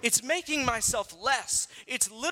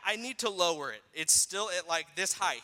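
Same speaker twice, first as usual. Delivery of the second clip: usual speaking voice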